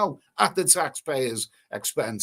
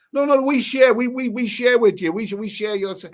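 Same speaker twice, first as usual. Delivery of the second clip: high, loud insistent mimic of a tourist; mimicking tourist; 7 semitones higher, 6 dB louder than usual. Retelling the tourists at the airport, loud insistent mimicry. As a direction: hammer the word share like a pushy traveller. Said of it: "I'm like".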